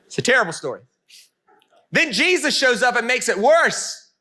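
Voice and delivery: ordinary talk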